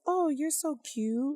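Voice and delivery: high, slow cooing mother voice; doting mom. Retold or imitated. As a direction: coo it slowly to a child. Voicing a mom praising her child.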